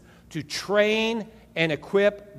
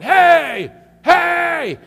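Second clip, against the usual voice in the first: loud, high shouted call; imitating a man shouting for attention; hollered hey; 12 semitones higher, 11 dB louder than usual. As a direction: bellow it across the water. Stranger calling out to the pastor.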